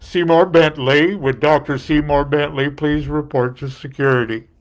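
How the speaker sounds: booming PA announcer voice; mock stadium loudspeaker call.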